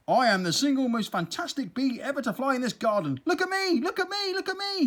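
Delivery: high, loud bragging; boastful crowing. Acted. Big-headed bee boasting to everyone, high and excited. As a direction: brag it to the whole garden.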